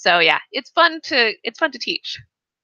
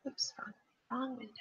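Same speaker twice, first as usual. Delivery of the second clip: whispered "oops, wrong window"; a barely audible unvoiced slip; whispered aside; 2 semitones higher, 22 dB quieter, faster than usual. Real, not acted. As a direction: whisper to yourself, fixing the screen. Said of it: "I didn't mean to go quiet on you".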